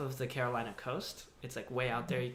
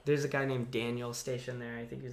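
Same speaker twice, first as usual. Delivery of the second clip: ordinary talk, nothing marked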